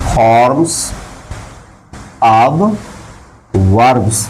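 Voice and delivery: "forms", "of", "verbs" with long pauses between; word by word. Shown as a demonstration. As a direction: dictate "forms of verbs" one isolated word at a time. Dictates term word by word. ("forms of verbs" हर शब्द के बाद लंबा रुककर)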